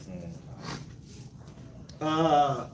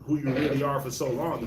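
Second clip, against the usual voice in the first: ordinary delivery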